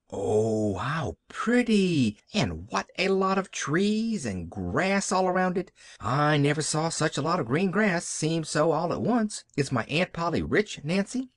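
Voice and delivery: Pollyanna marvelling. Acted. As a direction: gush in wonder, then ask eagerly. Child Pollyanna admires the house.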